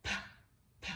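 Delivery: short puffed p sound; letter sound p. Shown as a demonstration. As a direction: say the p sound alone. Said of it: "The sound for P is".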